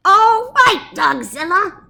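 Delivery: high, loud squeaky call; squeaky shout. Acted. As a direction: call out the monster. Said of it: "shouted the Big Cheese".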